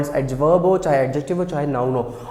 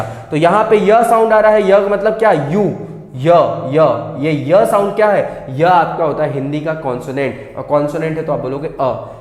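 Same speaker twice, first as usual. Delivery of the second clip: ordinary delivery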